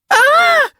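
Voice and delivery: very high-pitched scream. A narrator's reading. Karen sees the leopard drop, screaming. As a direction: shriek like a little girl. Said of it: "Karen screamed".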